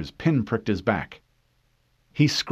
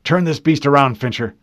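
loud, higher urgent command; loud order; 5 semitones higher, 9 dB louder than usual. A narrator's reading. Harlan ordering Fincher to turn the Warthog, loud. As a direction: shout the order at the driver.

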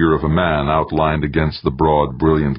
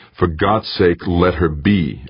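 usual speaking voice

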